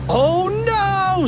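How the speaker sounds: high, drawn-out wail of horror; squealed alarm; mock scream. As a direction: shriek it like a doomed cartoon kid. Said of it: "screamed Fluffy".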